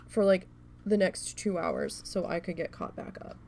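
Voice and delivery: usual speaking voice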